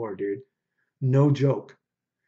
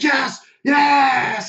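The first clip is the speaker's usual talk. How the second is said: high, loud imitated yelling of yes; a hammed-up triumphant scream; retold victory shout; 13 semitones higher, 6 dB louder than usual. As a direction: belt it out like the rival who finally won. Rival celebrating a tournament win.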